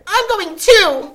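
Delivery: put-on very high, loud, slow little-boy whine; whiny little brother. A narrator's reading. Little brother insisting he comes too, high whine. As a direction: whine like a small boy left behind. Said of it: "said her little brother".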